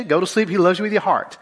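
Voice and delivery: ordinary delivery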